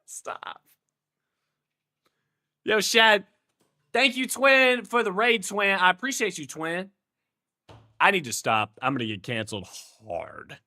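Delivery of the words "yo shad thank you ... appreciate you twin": raised, hyped bro voice; bro-speak thanks. Acted. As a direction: thank them like an effusive bro, easing down at the end. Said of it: "gonna start calling customers Twin".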